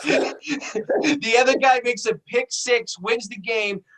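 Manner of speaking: story told through laughter, slow and high; real laughter breaking up the words; laughing through it. Real, not acted. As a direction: crack up while telling the ending.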